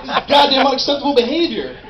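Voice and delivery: high, loud, fast furious rant; yelling at roommate. Retold or imitated. Roommate yelling about shit on the floor, furious. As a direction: blow up at the messy roommate. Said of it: "you'd be like".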